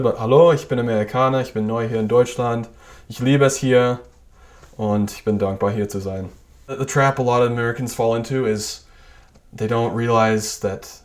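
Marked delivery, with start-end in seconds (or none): 0.2-6.3 careful native-style German accent; best German accent. Shown as a demonstration. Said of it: "my best German accent's probably still not very good".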